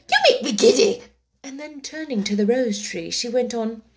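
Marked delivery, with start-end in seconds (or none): 0.1-1.0 loud, high scolding; screamed. A narrator's reading. The Queen scolds the bowing gardeners, screaming. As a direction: yell it in irritation. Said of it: "screamed the Queen".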